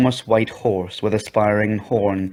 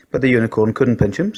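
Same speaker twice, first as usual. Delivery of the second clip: usual speaking voice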